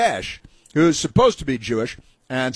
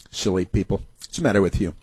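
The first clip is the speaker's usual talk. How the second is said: ordinary talk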